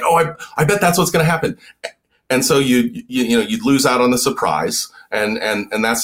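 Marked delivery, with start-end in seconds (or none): 0.0-1.6 eager guess. Retold or imitated. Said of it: "would be like".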